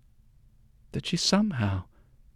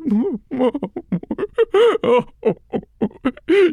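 high wailing laugh; 12 semitones higher, 8 dB louder than usual. Acted. Old woman overcome, mouthing words.

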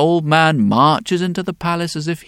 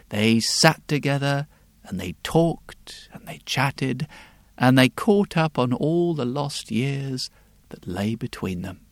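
hushed narration; 4 semitones lower, 6 dB quieter than usual. A narrator's reading. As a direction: lull the story to a tender close. Tender close of the story.

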